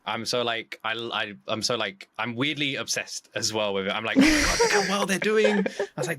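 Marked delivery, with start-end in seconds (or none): 4.2-6.2 high, louder gush; bubbling over, pitched up; excited admiration. Retold or imitated. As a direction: retell being amazed for friends. Retells own admiration of their channel.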